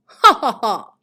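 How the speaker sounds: loud cackling villain laugh; evil laugh. Pretend. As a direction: ham up a maniacal cackle. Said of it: "that's my evil laugh".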